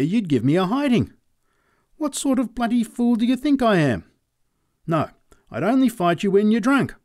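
high, nervous refusal voice; frightened farmer. Acted. Cowering farmer refusing to fight the sober man, high nervous voice. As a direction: back away from the fight in a high pleading voice.

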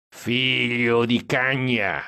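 long drawn-out curse, no real volume; mild yell. A narrator's reading. Stan cursing from the back seat, slow understated yell. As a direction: stretch the oath out slowly like a held-back holler. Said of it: "urlò Stan dietro".

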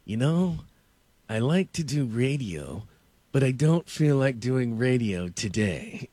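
slow, deliberate star brush-off; Jack Nicholson. Retold or imitated. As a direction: draw it out with lofty, measured pauses. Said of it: "And he goes".

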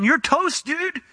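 high-pitched, urgent mock warning; demon warning. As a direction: panic the man in a high voice. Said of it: "The demon had told him".

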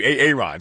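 "A Aaron" said as ay-ay-RON; nickname mispronunciation. Retold or imitated. Host quoting the board op's name for the guest, "A Aaron" as ay-ay-RON. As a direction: double the long A in "A Aaron", then RON. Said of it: "that's what he calls you".

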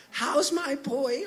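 high, slow mom voice; imitated doting mom. Mom picking up to her son, imitated high cooing voice. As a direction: coo it in a high, slow put-on voice. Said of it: "you hear her pick it up".

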